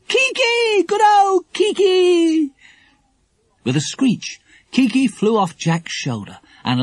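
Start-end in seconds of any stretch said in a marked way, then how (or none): 0.1-2.5 high, slow, louder cry of welcome; joyful shout. Acted. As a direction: greet the parrot from afar. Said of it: "shouted Lucy Ann".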